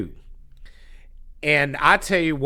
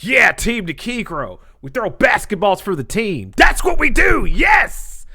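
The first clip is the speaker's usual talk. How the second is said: hyped team cheer; 6 semitones higher than usual. Retold or imitated. Telling what he should have said for the team, hyped cheer. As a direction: build up to a shouted team chant.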